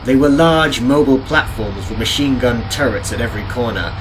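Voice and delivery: plain reading voice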